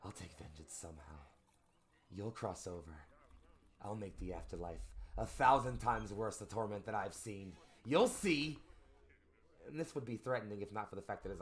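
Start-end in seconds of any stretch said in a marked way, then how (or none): 0.0-8.6 building, loud villain's threat; swearing vengeance, louder as it goes; vengeful threat. Acted.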